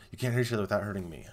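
loud, fast mock plea; hammed-up Bella plea; quoting Bella. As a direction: rush it out like a pleading heroine.

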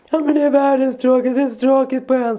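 mock whining about being bored; Svante. Son complaining to mum about the island, imitated child's complaint. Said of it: "säger Svante så här".

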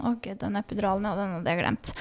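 relieved murmur. Retold or imitated. Mother remembering the epidural, soft relieved murmur. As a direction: breathe it out, relieved.

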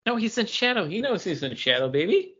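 ordinary talk, nothing marked

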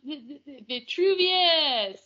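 "V-, v-, v-, Vitruvius" stammered, then slow; stuttering. Retold or imitated. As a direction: stumble on the V, then drag out "Vitruvius". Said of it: "I'm like".

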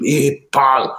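a light mock Scottish accent on a friendly greeting. Recruit recalling his first Scottish barrack-mates, light mock Scottish accent. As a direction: greet him like a broad Scottish squaddie, lightly imitated. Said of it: "it was that deep".

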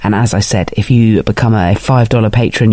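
normal talking voice

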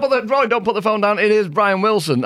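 high, hurried mock plea down the phone; imitated pleading. Acted caller pleading not to hang up.

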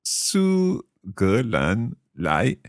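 苏格兰来 spoken very slowly; slow careful. Shown as a demonstration. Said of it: "We've already done".

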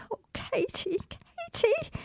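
high broken whispered aside; sobbed aside. Acted. Hurt child whispering to her sister mid-speech. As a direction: whimper her sister's name between lines. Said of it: "A big sob".

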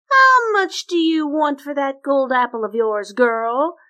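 sneering storybook princess; cartoon princess. Long-nosed princess haggles.

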